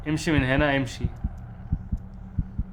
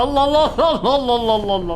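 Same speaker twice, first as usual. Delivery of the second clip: high, louder, laughing wows; delighted; 8 semitones higher, 4 dB louder than usual. Real, not acted. Gamer delighted by his new room, laughing high wows. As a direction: gush with delight.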